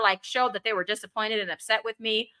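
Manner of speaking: ordinary talk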